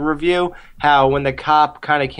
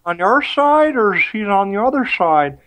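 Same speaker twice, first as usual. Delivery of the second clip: hammed-up drunk slur, pitched higher; fake drunk; 5 semitones higher than usual. Drunk character asking which side he is on, fake drunk. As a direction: imitate a bad actor playing drunk. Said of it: "trying to act drunk, like".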